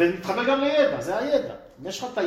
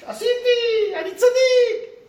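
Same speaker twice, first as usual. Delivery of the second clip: much higher, slow mocking boast of a self-satisfied giver; smug self-righteousness; 8 dB louder, slower than usual. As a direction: drawl it out like a smug do-gooder. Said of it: "וכאן נותנים צדקה ככה". (בחיקוי לועג של צדקן שחצן, גבוה ואיטי)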